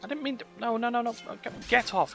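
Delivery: ordinary talk, nothing marked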